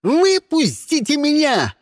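high, drawn-out frantic yell. A narrator's reading. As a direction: scream to be let out. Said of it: "заорал Кристиан". (истошный вопль высоким голосом)

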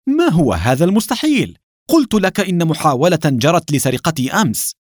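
indignant, high-pitched retort; sharp outcry. A narrator's reading. Shamir insisting he was nearly robbed, crying out sharply. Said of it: "صاح شامير بحدة".

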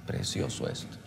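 hushed, low, airy murmur; soft, breathy wonder as if weeping; retold tearful awe. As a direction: re-enact being moved to tears, almost without voice. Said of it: "y yo llorando también decía".

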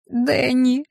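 imp sobbing. A narrator's reading. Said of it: "всхлипнул имп".

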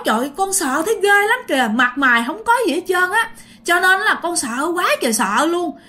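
high, agitated voice of a scared witness; acted fright, high and insistent; frightened retelling. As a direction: blurt out the scare, pitched well up. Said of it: "cho nên dì rất là sợ".

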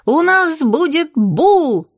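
high, drawn-out excited announcement; bursting to tell. A narrator's reading. Lyolya blurts the big news, thrilled. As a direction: proclaim it slowly with huge relish. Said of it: "не выдержала Лёлька".

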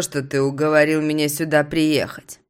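plain reading voice

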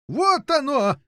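high-pitched joyful cry; delighted yelling. A narrator's reading. King hearing his beast near, high ecstatic cry. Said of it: "завопил он в восторге".

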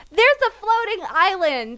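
put-on high-pitched, gushing kid make-believe; seven-year-old storyteller. Reviewer mocking a seven-year-old's fantasy, childlike wonder. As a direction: marvel like a child inventing fantasy. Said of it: "seven-year-old would write".

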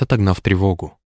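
usual speaking voice

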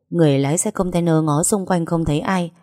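ordinary delivery

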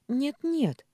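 high, loud, urgent protest. A narrator's reading. Маша objects to Михаил's refusal, protesting. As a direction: object at once, pitch leaping up. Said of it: "запротестовала Маша".

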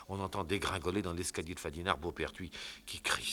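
ordinary delivery

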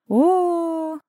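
long, high drawn-out "ooh"; singsong drawl. A narrator's reading. Woman reacting to his confession, singsong and high. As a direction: stretch it out high and knowing. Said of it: "нараспев протянула Иренка".

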